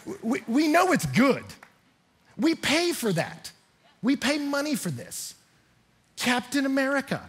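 high, emphatic pulpit delivery; impassioned preaching. Real, not acted. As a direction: preach it high with punchy phrases.

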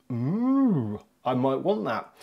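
playful "ooh" swooping up and back down; lightly acted tempted reaction; imagined intrigued viewer. Vlogger imagines viewer won over by the product, playfully intrigued. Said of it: "you'll be like".

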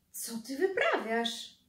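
strangled, embarrassed hiss-like question; choked-out protest. Acted. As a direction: blurt it out mortified. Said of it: "wykrztusił wujek Grzesiek". (wujek wykrztusza zażenowany)

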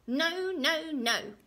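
high, loud repeated refusal; tantrum. Acted. Little man stamps round the room, angry. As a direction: stamp out each no, furious.

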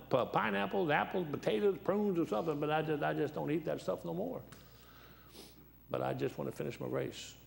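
ordinary talk, nothing marked

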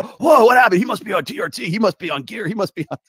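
high, loud mock astonishment; amazed viewers. Guest imitating stunned viewers, loud and high. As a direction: play the gasping viewers who suspect steroids. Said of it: "they go".

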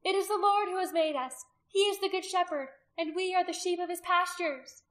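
high, loud woman proclaiming God; loud proclamation. A narrator's reading. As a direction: carry scripture over the crowd. Woman proclaims to hostile crowd.

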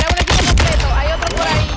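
loud, urgent warning. Real, not acted. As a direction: warn the squad loudly. Warning about enemy team. (advertencia en voz alta)

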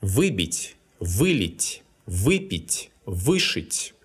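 Выбить, вылить, выпить, вышить with the first syllable stressed; Выбить, вылить, выпить, вышить, each stressed on вы, endings unstressed; stress on prefix вы. Shown as a demonstration. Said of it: "is stressed when it's a part".

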